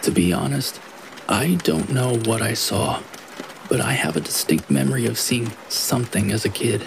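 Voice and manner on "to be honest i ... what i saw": ordinary delivery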